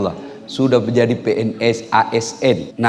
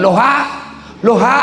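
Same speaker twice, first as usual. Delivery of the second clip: comic phone yelling; 11 semitones higher than usual. Acted. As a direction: holler the backwards greeting down the line. Old man yells into phone again.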